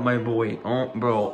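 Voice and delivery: ordinary delivery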